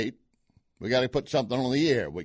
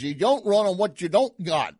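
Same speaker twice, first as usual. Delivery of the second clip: a touch of Cajun, higher and louder; lightly mock Cajun, punching don't; imitating Carville, higher and louder; Carville impression; 6 semitones higher, 5 dB louder than usual. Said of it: "what he goes on to say is".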